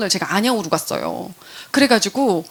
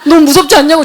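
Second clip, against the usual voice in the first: laughing insistence; 4 semitones higher, 10 dB louder, faster than usual. Real, not acted. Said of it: "진짜 무서웠어요".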